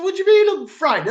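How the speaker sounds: high, loud and fast outraged comeback; indignant retort. Retold or imitated. Caller retelling his indignant reply to the shop girl, loud outburst.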